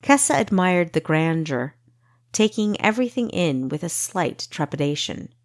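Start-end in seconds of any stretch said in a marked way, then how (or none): none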